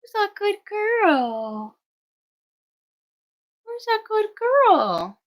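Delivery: high, slow sing-song to the puppies. Real, not acted. Greeting newborn puppies in high baby talk. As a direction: coo at them like babies. Praising puppy.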